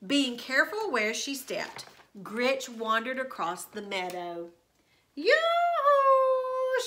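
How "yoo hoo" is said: high, loud yoo-hoo call; sing-song witch call. A narrator's reading. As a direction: call out across the meadow. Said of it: "she shouted".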